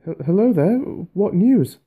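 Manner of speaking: high, loud, trembling call with a stammer; shaky call. Acted. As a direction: call out nervously, voice wobbling. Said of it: "he called with a shaky voice".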